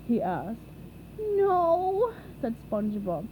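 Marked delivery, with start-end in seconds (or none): 1.2-2.1 whimper. A narrator's reading. Sick SpongeBob whimpers.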